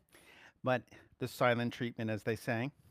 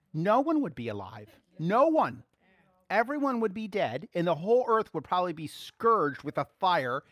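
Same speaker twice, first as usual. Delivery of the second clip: louder and louder, building to the peak; preaching force; 5 semitones higher, 6 dB louder than usual. Real, not acted. Preacher warning the church what God would do, building to a loud peak. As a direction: drive it home like a fiery preacher.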